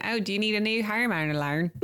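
hammed-up Australian accent. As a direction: ask it like a comic Aussie. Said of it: "Like an Australian".

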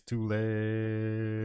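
very slow, stretched words; drawn out. Acted. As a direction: drag each word out slowly. Quoting a song title.

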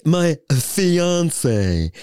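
"My fiance" drawn out, with a lofty air; snooty "My fiance" with a long fiance; posh fiance. Shown as a demonstration. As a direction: say "fiance" grandly, like showing off. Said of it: "And I say it like that too".

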